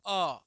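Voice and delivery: short अ called out loud; loud vowel demo. Shown as a demonstration. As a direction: bark a clipped अ for the class. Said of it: "अ बोलिए". (अ को ज़ोर से छोटा बोलना)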